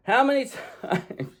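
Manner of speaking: high, breaking into a laugh mid-question; laughing at herself. Real, not acted. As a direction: laugh through the words at your own clumsiness.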